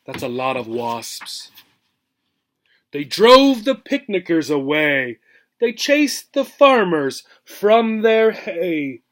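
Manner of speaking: lively storybook read-aloud, rhythmic and bouncy; expressive picture-book reading; reading to children. Acted. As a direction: read the rhyme to a class with relish. Teacher reads picture book aloud.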